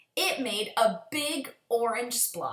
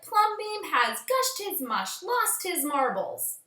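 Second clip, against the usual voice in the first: much higher and slow, scandalized; high, slow gossiping neighbors; 7 semitones higher, slower than usual. Acted. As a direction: drag it out like shocked busybodies. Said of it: "the people said".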